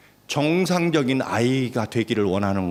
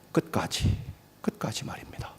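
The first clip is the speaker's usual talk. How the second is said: hushed, breathy emphasis; whispered repetition; 8 dB quieter than usual. Real, not acted. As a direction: drop to a whisper to drive it home. Preacher whispers the key phrase.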